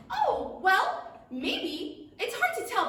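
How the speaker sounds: high, squeaky little dragonfly voice; tiny dragonfly voice. Acted.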